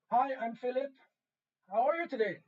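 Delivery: calling out loud and clear into the mic; loud radio call demo. Shown as a demonstration. Teacher demonstrating a radio greeting to the space station, loud. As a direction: show the kids how loud to speak. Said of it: "Och det blir till exempel".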